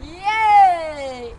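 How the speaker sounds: loud, drawn-out yay; excited shout; cheering. Acted. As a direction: celebrate with a big high whoop. Cheering the fossil find.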